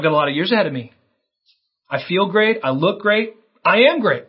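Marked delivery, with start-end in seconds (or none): 1.9-4.2 mildly puffed-up self-praise, rising higher; boastful. Acted. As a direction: build to a hammed-up last boast. Acting out a self-satisfied boaster.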